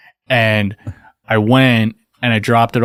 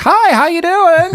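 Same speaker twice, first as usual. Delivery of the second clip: high, slow sing-song greeting; high, slow, sugary imitation of a friendly stranger; mock cheerful customer; 3 dB louder, slower than usual.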